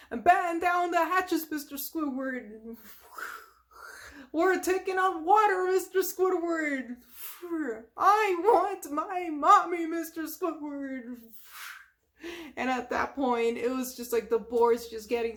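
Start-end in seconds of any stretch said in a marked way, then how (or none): 0.1-7.0 high, urgent captain's cry; Mister Krabs. Retold or imitated. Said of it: "Mister Krabs is just like". 8.0-11.2 high, drawn-out mock wail; Mister Krabs. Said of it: "Mister Krabs is just like".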